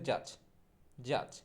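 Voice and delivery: জাজ with an open "a" vowel in place of "o"; corrected pronunciation. Shown as a demonstration. Teacher correcting judge, জাজ with open "aa". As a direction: say জাজ as "jaaj". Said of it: "সঠিক উচ্চারণটা হবে".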